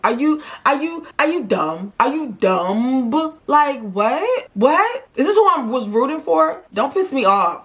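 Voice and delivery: high, rising disbelief; incredulous exasperation. Real, not acted. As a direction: demand an answer, voice shooting up. Exasperated rant at contestant.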